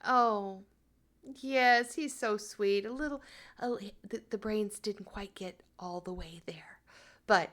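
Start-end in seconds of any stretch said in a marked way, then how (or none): 2.8-6.8 a gossipy whisper, voiceless; whispered aside. Acted. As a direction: whisper it behind your hand. Gossipy aside about brother.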